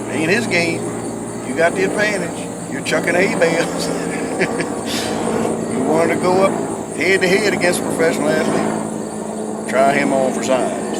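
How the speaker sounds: a hint of God needling him. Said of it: "God is thinking".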